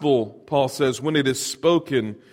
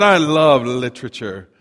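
lower, savoring take on LITERATURE; imitated literature lover; 3 semitones lower than usual. Opening speaker addressing homeschool kids, savoring relish. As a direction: lightly imitate a speaker proclaiming a love of literature. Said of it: "he said it that way".

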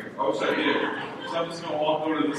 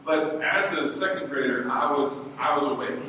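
usual speaking voice

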